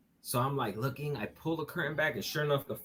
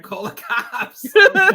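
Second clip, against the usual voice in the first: laughing; 11 semitones higher, 17 dB louder, slower than usual. Retold or imitated. Retelling his panicked reply.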